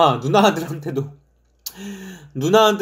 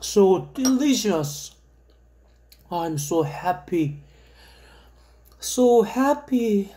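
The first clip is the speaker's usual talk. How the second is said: very slow, drawn-out and dreamy; slow dreamy English; 4 semitones higher, much slower than usual. Pretend. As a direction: stretch every word out in bliss. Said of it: "savouring cereal in slow English".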